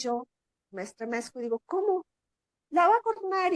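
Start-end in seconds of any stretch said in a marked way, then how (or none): 1.7-3.5 alarmed. Retold or imitated. Alarmed retort near the bull.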